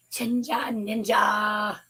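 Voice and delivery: a squeaky, pinched voice. Real, not acted. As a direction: squeak out the words. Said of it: "my voice is squeaky".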